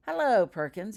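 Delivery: loud, bright mock-friendly hello; cheerful greeting. A narrator's reading. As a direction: greet him with fake cheer. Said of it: "he said cheerfully".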